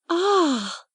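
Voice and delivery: high delighted gasp; a sudden thrilled "Ah!"; cried out. A narrator's reading. Girl spotting longed-for books on the table, thrilled. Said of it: "she cried out".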